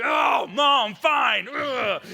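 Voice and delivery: loud, high groaning give-in; exasperated teen shout. Retold or imitated. Son giving in to mom, mock exasperated yell. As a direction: cave in with a loud huff. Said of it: "He's like".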